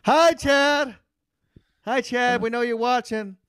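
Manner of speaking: high, sing-song teasing call; mock taunting greeting. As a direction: taunt him like a playground bully. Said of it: "what they're saying is".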